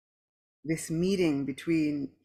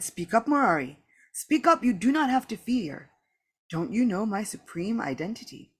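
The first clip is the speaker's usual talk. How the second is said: raised, louder voice of a commanding deity; the Lord urging; 5 semitones higher, 5 dB louder than usual. A narrator's reading. The Lord urges speechless Murari to speak, commanding. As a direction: press a speechless devotee to answer. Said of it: "The Lord then said".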